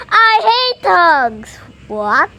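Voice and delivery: lightly voiced cranky shrimp; grumpy Shrimpo. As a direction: spit out every hate like a sulking kid.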